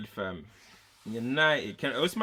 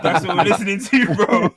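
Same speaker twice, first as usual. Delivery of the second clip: loud, laughing, higher; 5 semitones higher, 10 dB louder than usual. Real, not acted. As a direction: crack up while you say it. Mocking Europa anthem.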